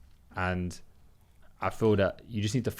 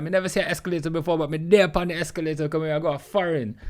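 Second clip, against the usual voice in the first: higher-pitched, excited Jamaican patois; happy Jamaican accent; 6 semitones higher than usual. Retold or imitated. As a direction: imitate an overjoyed Jamaican traveller. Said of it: "he's just so happy".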